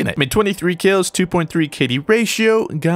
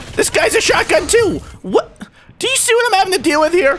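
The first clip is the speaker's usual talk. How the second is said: very high, incredulous rant; indignant gamer squawk; exasperated outburst. Real, not acted. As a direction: complain in high-pitched outrage. Exasperated by shotgun users.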